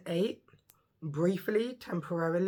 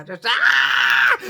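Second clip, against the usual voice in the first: loud burst of energy; excited shout; 5 semitones lower, 12 dB louder than usual. Real, not acted. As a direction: blurt out a joyful yell. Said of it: "with my energy right now".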